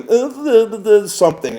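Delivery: high, loud gibberish babble; mock rambling. Driver mimics girls still rambling, mock babble. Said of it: "they're still rambling".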